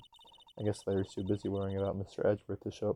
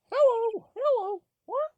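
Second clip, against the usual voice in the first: much higher, loud squawking parrot; 8 dB louder, slower than usual. Acted. Parrot greeting visitors at the empty house, squawking. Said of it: "Hey, it's Polly".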